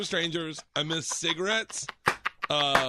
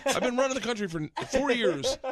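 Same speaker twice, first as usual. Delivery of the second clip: higher-pitched eye-rolling disbelief; imitated weary indignation, pitched up; mock exasperation; 7 semitones higher than usual. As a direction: act put-upon and incredulous. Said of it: "like, rolling his eye".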